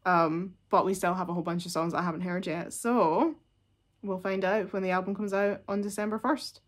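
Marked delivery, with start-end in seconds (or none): none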